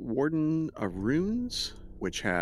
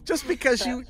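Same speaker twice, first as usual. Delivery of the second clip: high, excited disbelief; incredulous; 4 dB louder than usual. Real, not acted. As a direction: push them, half laughing. DM pushes players to take sword.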